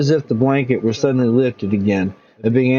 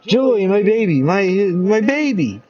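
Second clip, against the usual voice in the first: higher, slowing tender cry; higher tender mother's cry; tearful mother; 7 semitones higher, slower than usual. Acted. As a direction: hold him and melt. Mother embraces returning son.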